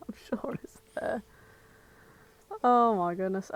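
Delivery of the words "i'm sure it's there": unvoiced, soft whisper of her own words; whispered aside. Real, not acted. Podcaster repeats her remark as a whisper. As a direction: breathe it as a private aside. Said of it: "repeats own line whispered".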